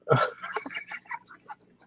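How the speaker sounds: high, airy laugh-squeal; wheezing giggle. Real, not acted. To his viewers over a stupid joke, a wheezing giggle. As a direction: laugh till the voice gives out. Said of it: "That's what I keep laughing at".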